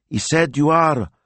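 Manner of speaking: stalling for a word; hesitant. A narrator's reading. Pausing, searching for word.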